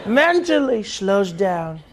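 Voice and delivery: slowed-down mock. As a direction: drag the words like your mind is slowing. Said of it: "doing my Sleepy Joe".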